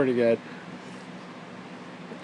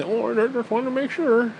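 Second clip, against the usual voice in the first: high, timid little voice; meek friend; 7 semitones higher than usual. Retold or imitated. Friend excusing himself, timid high voice. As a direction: back down sheepishly. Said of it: "friend's meek reply".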